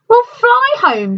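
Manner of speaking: high, slow, grand announcement; cheerful wizard. A narrator's reading. Wizard showing Dorothy the balloon, slow and high.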